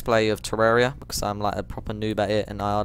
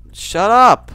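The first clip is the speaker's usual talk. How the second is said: loud, high annoyed yell; 11 semitones higher, 13 dB louder than usual. Real, not acted.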